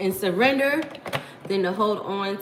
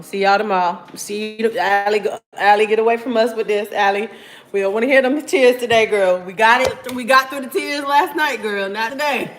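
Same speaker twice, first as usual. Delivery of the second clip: mock fed-up dismissal; 3 semitones higher, 6 dB louder than usual. Acts out fed-up viewers dismissing her.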